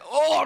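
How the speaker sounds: sudden high shriek as the milk hits; pained scream. Retold or imitated. Pouring milk on a maced face, high pained shriek. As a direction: cry out in burning pain. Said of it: "I'm, like, screaming".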